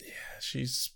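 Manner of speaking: whispered aside. Real, not acted. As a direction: whisper it conspiratorially. Aside about Harmony.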